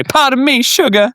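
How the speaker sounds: "Pardon me, Sugar" in a high Southern drawl; Southern accent. Shown as a demonstration. Southern accent, the phrase needed to play the card. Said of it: "You have to do the accent".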